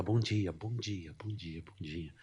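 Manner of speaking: soft, timid good mornings; quiet greeting. Retold or imitated. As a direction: greet softly, not wanting to be noticed. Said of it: "Não aumenta a voz". (bom dia baixinho, tímido)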